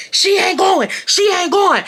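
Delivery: imitating a yelling boyfriend; retold yelling. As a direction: shout it like he did on the phone. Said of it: "yelling on the phone, screaming".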